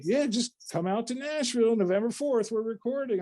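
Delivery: easygoing Southern songwriter inviting him to Nashville; Southern man accent. Retold or imitated. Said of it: "my accents are horrible".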